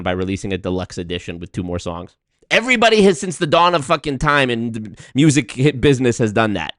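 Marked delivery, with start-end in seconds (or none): 2.5-4.5 exasperated outburst. Real, not acted. Ranting about deluxe albums.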